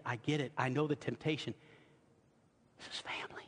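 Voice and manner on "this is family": near-whisper, very soft; soft, whispered aside; hushed intimacy. Real, not acted. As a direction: drop right down as if confiding.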